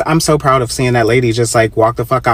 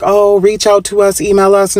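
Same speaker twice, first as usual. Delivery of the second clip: mock customer service; 6 semitones higher than usual. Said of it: "respond to my review saying".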